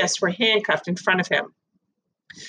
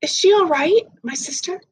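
put-on anxious girl; 10 semitones higher than usual. Narrator girl asks anxiously.

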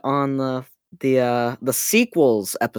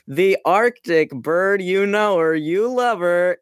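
imitating intro voice; 4 semitones higher, slower than usual. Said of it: "you were like".

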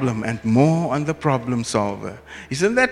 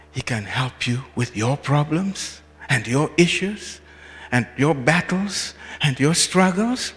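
normal talking voice

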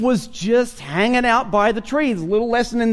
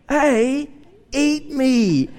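tempting donut; 3 semitones higher, much slower than usual. Pretend. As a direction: coax like a sweet treat. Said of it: "And they say".

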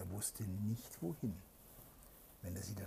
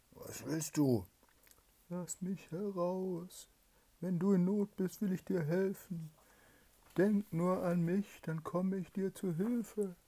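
pleading spirit; 10 semitones higher, 7 dB louder than usual. Acted. Trapped spirit begs the soldier to free him, high eerie pleading. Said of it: "antwortete eine Stimme".

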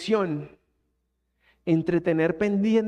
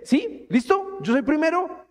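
playful God voice; 7 semitones higher, slower than usual. Retold or imitated. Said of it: "Dios te dice".

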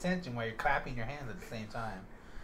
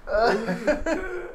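loud, high, drawn-out laughing outburst; laughing hard; 9 semitones higher, 14 dB louder, much slower than usual. Real, not acted. Reacting to a friend being called papi, laughing hard. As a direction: say it through real, helpless laughter.

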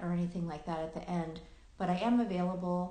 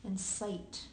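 unhurried meditation guiding with long pauses; guided meditation; 4 dB quieter than usual. Acted. Host guiding listeners into present awareness, calm meditation voice with long pauses. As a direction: lead listeners gently through their senses, leaving long silences.

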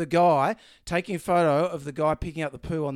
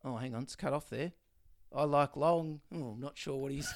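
ordinary delivery

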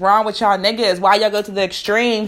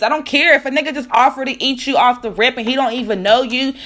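usual speaking voice

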